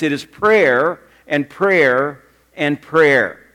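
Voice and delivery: each prayer drawn out and weighed; slow it right down on prayer and prayer and prayer; slow, deliberate repetition. Retold or imitated. Preacher quoting Billy Graham on his crusades, slow and deliberate.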